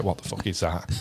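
low muttered what the; hushed disgust. Retold or imitated. At a formal tea ceremony, hushed disgust. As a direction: swallow the shock quietly. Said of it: "be like".

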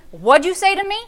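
put-on a mother daring her kid to repeat it; stern mother. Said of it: "you do not say no to your mother".

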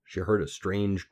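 usual speaking voice

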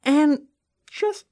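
high, soft, hammed-up sorrow; mock heartbreak. Mourning the poor corn boy in a mock-heartbroken high voice. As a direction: whimper it like a sad puppy.

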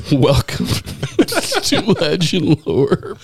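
slow welcome broken by laughter; laughing through it. Acted. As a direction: try to get the intro out while cracking up. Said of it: "so eloquently put it".

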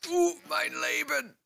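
very high falsetto, mock German; high squeaky mock German.

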